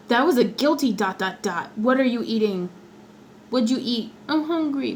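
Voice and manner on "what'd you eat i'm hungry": ordinary talk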